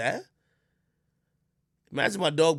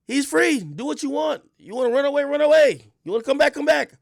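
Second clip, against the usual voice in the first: excited; 10 semitones higher, 6 dB louder than usual. Real, not acted. Carefree dogs on Jamaican land.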